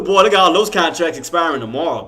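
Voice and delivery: normal talking voice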